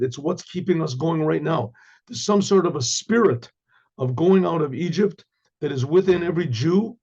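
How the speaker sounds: normal talking voice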